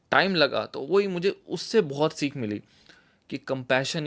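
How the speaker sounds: normal talking voice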